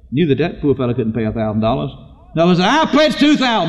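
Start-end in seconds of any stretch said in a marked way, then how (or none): 2.7-3.7 high, ringing declaration; proclaimed pledge. Retold or imitated. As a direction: outbid the room, pitched way up. Acted rival pledge.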